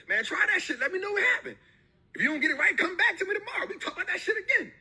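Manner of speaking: high and laughing, pumped up; laughing encouragement. Real, not acted. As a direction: egg him on through laughter.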